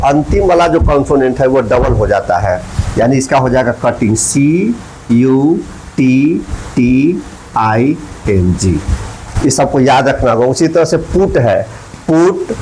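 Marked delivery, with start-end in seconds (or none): 4.2-8.8 very slow, each letter separate; spelling out. Shown as a demonstration. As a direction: dictate letter by letter with long gaps. Spells cutting while writing it. (बहुत धीरे, एक-एक अक्षर अलग बोलते हुए)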